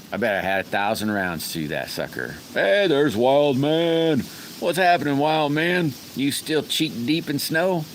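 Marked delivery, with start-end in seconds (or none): 2.5-7.8 high, loud, stretched-out hello; excited greeting. Real, not acted. Streamer welcoming a regular who joined the chat, loud and high. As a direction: boom out a cheerful welcome.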